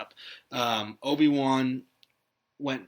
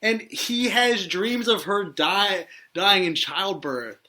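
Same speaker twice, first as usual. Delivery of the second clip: higher and slow, hammering each point; indignant emphasis; 6 semitones higher, 4 dB louder, slower than usual. Real, not acted. Host arguing about her dying in childbirth, indignant. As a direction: press the case, appalled. Indignant argument over film plot.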